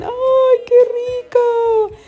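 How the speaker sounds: high, loud, drawn-out squeal; squealing over the churro dessert; delighted squeal. Real, not acted.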